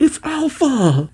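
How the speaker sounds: high-pitched, drawn-out mock cry of alarm; mock yell. Crew spots Alpha racing towards them, mock yell. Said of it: "They yell out".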